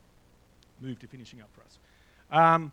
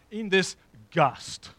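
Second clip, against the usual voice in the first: slow, relished "in this gust", louder and higher; savouring an old word; 5 semitones higher, 9 dB louder, much slower than usual. Acted. Preacher quoting John Owen's old phrase to the church, slow and savoured. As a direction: linger fondly over the quaint Puritan word. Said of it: "Love their language".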